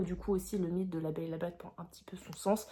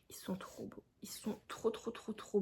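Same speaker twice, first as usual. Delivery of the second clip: hushed whisper of admiration; awed whisper; 12 dB quieter than usual. Real, not acted. Vlogger whispering over beautiful book covers, awed. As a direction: breathe the words out in awe.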